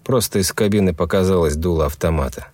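ordinary delivery, nothing marked

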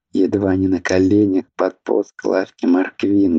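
usual speaking voice

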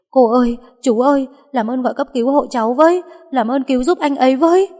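loud, high, desperate plea; crying out for help. A narrator's reading. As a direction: shout to bystanders, begging for help. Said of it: "mà gào lên". (gào lên cầu cứu)

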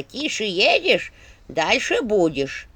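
very slow proverb in a put-on staff voice; Черепушка. A narrator's reading. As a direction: draw the saying out, pointedly instructive. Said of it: "заметила Черепушка". (назидательный посох, нараспев и медленно)

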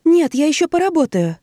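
pitched high and tense; high tense refusal. Acted. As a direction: grip the words, refuse high. Iva refuses, clutching pen.

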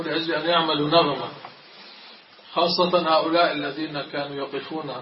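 usual speaking voice

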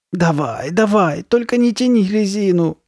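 high, whining plea; pleading. A narrator's reading. Carly Beth urging her friend on, pleading. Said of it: "взмолилась Карли Бет".

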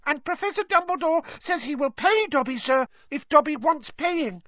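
very high, squeaky, excited elf; Dobby. A narrator's reading. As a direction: rattle on proudly in a piping elf voice. Dobby continues his story.

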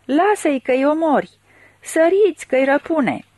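high, urgent cries for help; crowd crying out. A narrator's reading. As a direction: plead over a crowd to stop a killing. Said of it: "Lumea țipa".

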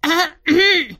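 sudden strangled cough; choked throat clearing. Acted. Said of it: "судорожно кашлянула".

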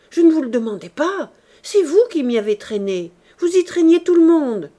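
loud, rising protest at the uncle; angry retort. Acted. Nephew snapping back at the scolding uncle, loud and angry. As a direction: shout him down, fed up and defensive. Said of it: "à la fois gêné et furieux".

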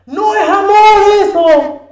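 high, very loud preacher's cry; fervent exclamation. Real, not acted. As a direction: cry it out to the congregation. Predicador exclama con fervor. (exclamación ferviente a voz en cuello)

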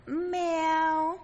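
high, cat-like mew of the word; woman mewing at her meal; meowing like a cat. Retold or imitated. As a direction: say it as a cat's meow. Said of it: "ăn meo meo như mà dòng mèo vậy".

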